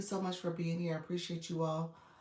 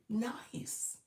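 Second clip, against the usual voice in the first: usual speaking voice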